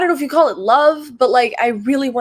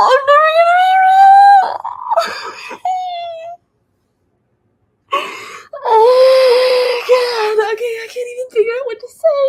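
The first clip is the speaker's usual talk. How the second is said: real crying shout, pitch far higher and louder; desperate sobbing cry; wailing sob; 13 semitones higher, 5 dB louder than usual. Real, not acted. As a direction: wail it out, voice cracking high. Breakdown, wailing.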